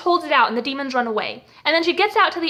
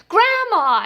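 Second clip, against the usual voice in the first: high, delighted call to grandma; mock-joyful high cry; happy greeting; 5 semitones higher than usual. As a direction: imitate a gleeful grandson calling out. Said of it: "he sounds all happy about it".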